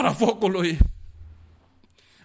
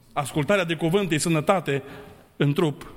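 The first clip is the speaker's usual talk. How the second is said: ordinary delivery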